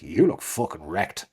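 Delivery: low, slow mock blunt insult; blunt guy. Colleague greeting a guy, low slow deadpan insult. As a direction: drop into a deadpan bloke. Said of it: "a guy walks in and is like".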